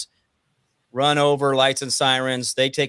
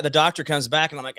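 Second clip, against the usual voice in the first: ordinary talk, nothing marked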